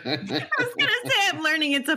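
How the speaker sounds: high, laughing through the words. Real, not acted. Host laughing with the guest, laughing high. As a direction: giggle it out.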